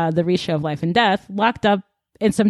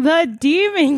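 higher and louder, a gasp of disbelief; dramatic reveal; 9 semitones higher, 4 dB louder than usual. Real, not acted. Friend recapping the book, stunned by the twist. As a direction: announce it like a shocking twist.

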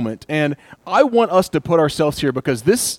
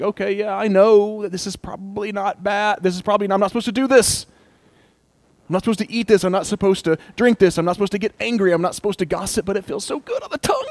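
higher voice arguing with himself; mock self-justifying; 6 semitones higher, faster than usual. Preacher acting out a tempted believer, higher and pleading. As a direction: play the sinner talking himself into it. Acted inner voice justifying sin.

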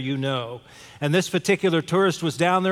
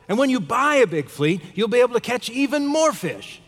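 raised pitch, acted self-important pitch; indignant, emphatic advice; 6 semitones higher than usual. Harvard MBA tourist advising a fisherman, indignant and emphatic. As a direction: lay out the grand plan insistently, voice up high. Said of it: "he said to him aloud".

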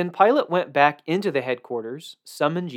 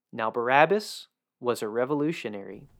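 plain reading voice

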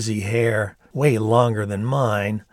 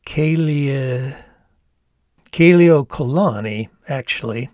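tentative; 3 semitones higher, 5 dB louder than usual. A narrator's reading. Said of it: "finally saying tentatively".

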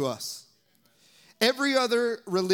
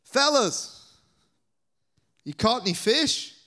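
called out high and loud across the water; calling out; 7 semitones higher, 5 dB louder than usual. Retold or imitated. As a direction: hail the fishermen from the shore. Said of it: "he called out".

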